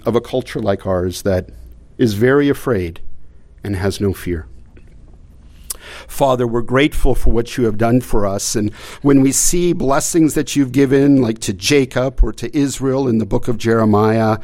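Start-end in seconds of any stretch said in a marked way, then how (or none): 6.1-14.4 prayer. Acted. Closing prayer.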